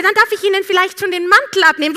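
loud, high, fast sugary politeness; overly polite service voice; sweet flight attendant. Retold or imitated. Attendant politely offers again.

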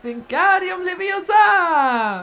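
loud, high, drawn-out incantation; spell-casting. Acted. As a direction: cast the spell with full gusto. Casts a spell with wand. (Zauberspruch laut ausgerufen)

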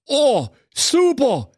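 high, drawn-out delight; delighted fool. Acted. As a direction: stretch it out, gleefully high. Thorsten pleased by the threat. (hoch und gedehnt begeistert)

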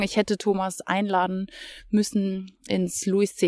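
ordinary talk, nothing marked

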